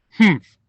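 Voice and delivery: loud disapproving grunt; grandma's annoyed humph; displeased humph. A narrator's reading. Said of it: "She wasn't happy with Vivian".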